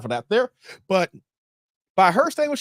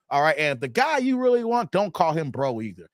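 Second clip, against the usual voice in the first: ordinary talk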